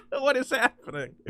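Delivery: high, wheezing laughing fit; laughing in disbelief; real helpless laughter. Real, not acted. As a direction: crack up, then speak through the laugh. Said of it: "I'm laughing because Superman".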